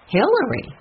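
HILLARY pitched high, then falling, in shock; astonished echo. A narrator's reading. The others react to Jessie naming a suspect, astonished. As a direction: repeat the name in disbelief. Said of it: "The others were so surprised".

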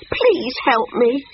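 high, drawn-out monkey plea; pleading cry. A narrator's reading. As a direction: beg slowly for rescue. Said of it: "cried Monkey".

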